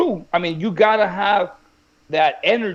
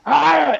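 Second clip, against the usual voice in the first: high, retold battle yell; imitated fighter's roar; 7 semitones higher, 4 dB louder than usual. Fighter reacting to coach's goading, imitated roar. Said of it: "The way he reacted".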